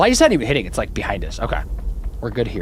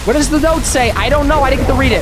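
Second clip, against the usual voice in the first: high, loud frantic yelling; panicked shouting; 7 dB louder than usual. Real, not acted. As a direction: shriek for help while running. Monster chasing him.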